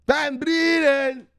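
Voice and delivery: Van Brienen! yelled high and loud, slow; hyped shout. Shown as a demonstration. Vlogger showing how Van Brienen goes, shouted. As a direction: stretch out "Van Brienen" in a big yell. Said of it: "That's how Van Brienen goes".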